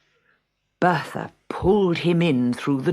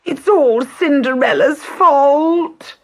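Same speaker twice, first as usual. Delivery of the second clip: high, loud, slow whine; whining; 12 semitones higher, 7 dB louder, slower than usual. A narrator's reading. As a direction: whine like a spoiled stepsister. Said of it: "whined Bertha".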